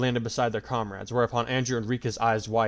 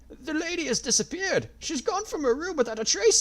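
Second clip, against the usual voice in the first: put-on panicked old man. A narrator's reading.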